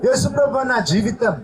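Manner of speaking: earnest pleading prayer, phrase by phrase; fervent prayer. Acted. Pastor leading the congregation's prayer aloud, fervent pleading prayer. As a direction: pray it aloud for all to follow.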